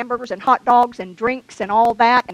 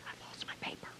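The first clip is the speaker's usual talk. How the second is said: whisper; 27 dB quieter, much faster than usual. Real, not acted. Aside at the pulpit during the service.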